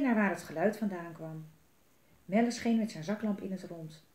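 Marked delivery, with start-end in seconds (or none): none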